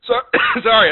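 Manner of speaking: coughing through words; sputtering after swallowing wrong; choking on a drink. Real, not acted. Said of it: "I'm choking on my wine".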